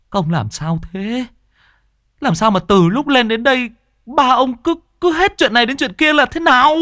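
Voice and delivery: very high wail, growing loud; exasperated scolding. Acted. Villager scolds the battered men.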